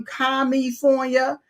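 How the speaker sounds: California said as Commie-fornia, drawn out on a rise; Commiefornia. Shown as a demonstration. Host greeting a state, mocking its name as Commie-fornia. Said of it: "say California instead of California".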